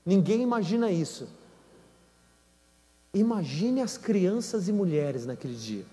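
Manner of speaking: ordinary talk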